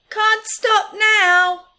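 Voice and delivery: high, loud, slow squeak; hurried little mouse. Acted. Little Mouse refusing Big Mouse while busy, high squeak. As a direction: squeak it out in a rush.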